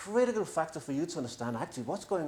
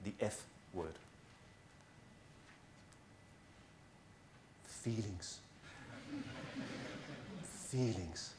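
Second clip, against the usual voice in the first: much softer, lower and held back; hushed reveal; 4 semitones lower, 14 dB quieter, slower than usual. Acted. Speaker to finance experts, softly revealing the taboo word. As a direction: drop low and say it like a taboo word. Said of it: "a word that may make some of you feel uncomfortable".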